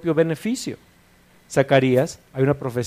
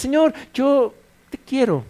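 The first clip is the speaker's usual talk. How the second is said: much higher, pleading believer; 11 semitones higher than usual. Pretend. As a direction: plead it high, like a needy lover. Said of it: "imagínate que le dices".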